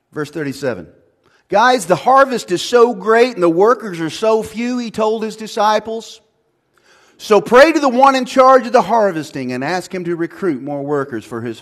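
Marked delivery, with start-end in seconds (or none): 1.5-11.6 loud, high, driving sermon voice; preaching at full force. Acted. Pastor to congregation, loud, passionate preaching. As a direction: hammer it home to the congregation.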